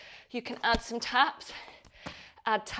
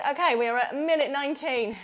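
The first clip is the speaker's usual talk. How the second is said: ordinary talk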